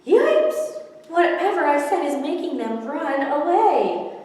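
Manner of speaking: high, loud startled yelp. Acted. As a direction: jump back in alarm. Fish character reacts.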